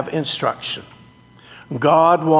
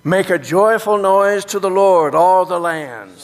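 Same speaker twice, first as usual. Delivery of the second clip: upbeat liturgical call; 3 semitones higher, 3 dB louder than usual. Shown as a demonstration. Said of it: "Here we go".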